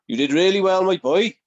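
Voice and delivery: loud, high-pitched mock praise; hearty mentor impression. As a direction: boom it out as the veteran praising the lad. Voices older wrestler's praise in story.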